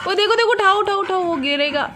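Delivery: high urgent warning; urgent alarm. Real, not acted. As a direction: shout to get someone to grab it. Urgent alarm, object falling. (ऊँची घबराई चेतावनी)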